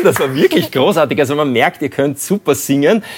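ordinary delivery

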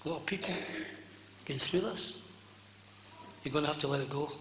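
soft, low, unhurried ministering with long pauses; gentle altar call. Acted. Preacher inviting the congregation to let God heal them, gentle altar call. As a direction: invite them quietly, letting each phrase settle.

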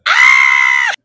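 sudden high scream; shocked scream. Real, not acted. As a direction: cry out in shock. Sees photo of choking.

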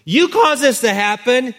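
high, loud accusing outburst; acted blaming wife. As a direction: throw the blame at him. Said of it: "And so who does she blame?".

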